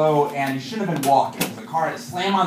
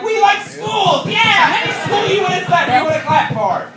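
dumb cheering crowd; 6 dB louder than usual. Retold or imitated. Mocking the Princeton crowd who clap for any school, loud and high. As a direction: ham up a crowd that cheers anything. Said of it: "They're like".

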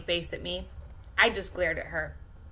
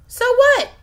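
sharp, much higher and louder retort; spat back; 12 dB louder than usual. Acted. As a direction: snap it back, cutting off the self-pity. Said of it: "Missus V spat back".

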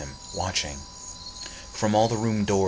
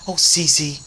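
rapid plea; 9 semitones higher, 7 dB louder than usual. Acted. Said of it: "came from him in one long".

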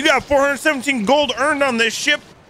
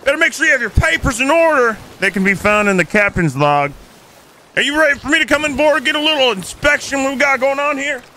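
shouted sheriff warning; 4 semitones higher than usual. Pretend. Sheriff repeats his warning to the pirate: shouted. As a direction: bark the order across the deck.

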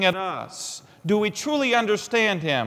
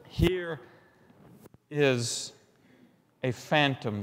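hushed; 4 semitones lower, 7 dB quieter, slower than usual. Acted. Hushed eerie quote.

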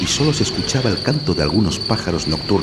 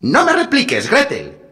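wicked stepmother=15; 4 dB louder than usual. A narrator's reading. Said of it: "dijo la madrastra".

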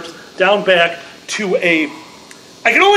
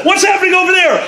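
higher, louder excited question; a player itching for adventure; mock eager adventurer asking; 9 semitones higher, 3 dB louder, much faster than usual.

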